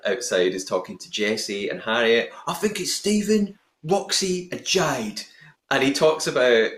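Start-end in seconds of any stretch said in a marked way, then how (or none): 2.5-5.2 high-pitched put-on accent, slowing at the end; Danny Dyer accent. Host quoting Adam's suspicions to listeners, put-on Danny Dyer-style accent. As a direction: imitate a contestant in a put-on accent. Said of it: "there was another accent".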